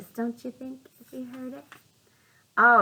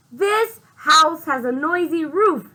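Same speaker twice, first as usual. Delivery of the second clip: louder annoyed shout; yell; 7 semitones higher, 8 dB louder than usual. Acted. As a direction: yell at the roof. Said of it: "yelled Owl".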